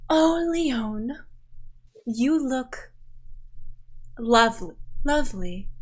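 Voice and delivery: fond, gushing mother voice. Acted. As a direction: coo over her like a proud mother.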